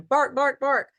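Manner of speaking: high yappy mock barking, like a small dog; imitated dog barks. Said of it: "little man's going".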